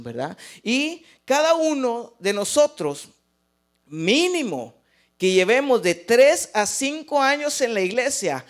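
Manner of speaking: fervent pulpit preaching. Real, not acted. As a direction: exhort the congregation with force. Preaching to congregation.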